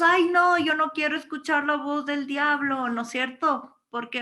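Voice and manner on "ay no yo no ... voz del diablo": higher, louder mock-alarmed protest; mock dread. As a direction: play up a light recoil from the devil. Acting out people fearing devil's voice.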